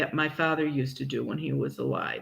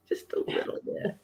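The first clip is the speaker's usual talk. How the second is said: breathy whisper, sly and playful; whispered aside; 7 dB quieter than usual. Real, not acted. Medium repeating her sly tip to the host, whispered.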